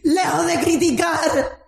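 high, shouting sermon at full force; fiery preaching. Real, not acted. Pastor urging the church to rise, shouting fervently.

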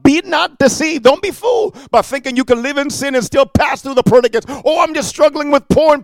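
loud, pounding sermon warning; preaching at full force. Acted. Preacher warning sinners of judgment day, preaching loud. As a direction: thunder the warning over the crowd.

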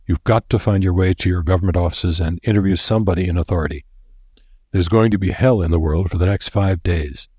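ordinary delivery

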